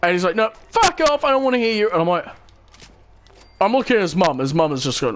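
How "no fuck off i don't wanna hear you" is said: high-pitched, heated retold outburst; angry dismissal. Cadet refusing to hear the teller out, angry. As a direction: imitate him angrily cutting you off. Acted quote of hostile cadet.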